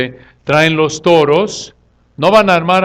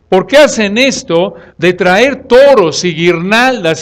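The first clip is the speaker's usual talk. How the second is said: loud, drawn-out, indignant appeal; acted protest; 4 semitones higher, 5 dB louder, slower than usual. Preacher paraphrases Paul challenging the crowd, loud protest. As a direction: press the question, leaning on key nouns. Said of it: "Pablo está diciendo".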